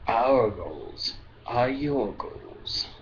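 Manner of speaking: very slow, ominous alien statement; slow, hissing Drakh voice; low sibilant voice. A narrator's reading. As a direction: draw each phrase out like a sibilant creature. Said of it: "spoke in a low, sibilant tone".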